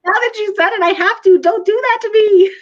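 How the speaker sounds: high, pleading mock protest; exasperated mom. Mom to defiant son about spanking, exasperated.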